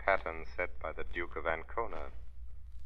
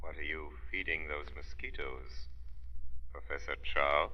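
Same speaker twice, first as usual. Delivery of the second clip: eerie unseen voice; 4 dB louder than usual. Acted. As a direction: speak as a voice from the shadows. The Shadow as unseen voice.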